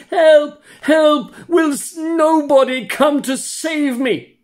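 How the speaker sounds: high, loud desperate cries for help; crying out for help. Acted. Puppet swallowed by a dogfish, screaming for help. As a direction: scream for rescue from inside the monster. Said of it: "he began to cry and scream".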